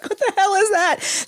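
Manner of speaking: high, alarmed outburst; startled. Retold or imitated. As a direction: jump out of your skin. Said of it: "You're like".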